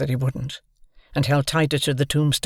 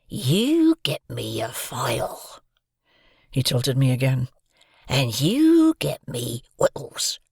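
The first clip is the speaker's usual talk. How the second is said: slow, hoarse menacing whisper; threatening convict whisper; slower than usual. A narrator's reading. Convict threatening to eat the boy, slow menacing whisper.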